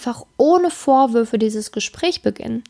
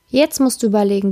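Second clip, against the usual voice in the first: ordinary talk, nothing marked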